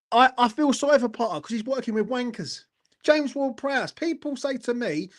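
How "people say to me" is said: normal talking voice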